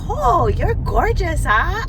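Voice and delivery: imitating a stranger hitting on her; flirty guy. Retelling a driver calling from the next car, mock flirty voice. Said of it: "and he's like".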